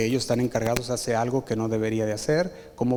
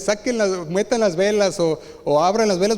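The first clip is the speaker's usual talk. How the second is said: mock shouted orders; 7 semitones higher, 4 dB louder than usual. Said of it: "Por más que grites".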